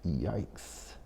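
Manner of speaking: a quiet, dropped-voice yikes; mock hushed yikes. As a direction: mutter it under your breath, cringing. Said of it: "I said".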